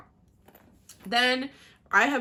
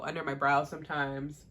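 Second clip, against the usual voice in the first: ordinary talk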